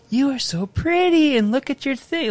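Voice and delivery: high, gushing princess voice; sweet princess. Retold or imitated. Dad imitating Princess Fiona praising his daughter, high and gushing. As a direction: coo like a storybook princess to a child. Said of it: "and she said".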